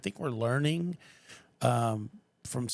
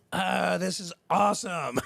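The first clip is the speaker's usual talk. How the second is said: slow, high, louder sigh of delight; 6 semitones higher, 5 dB louder, slower than usual. Retold or imitated. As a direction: savour the moment. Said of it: "it was just like".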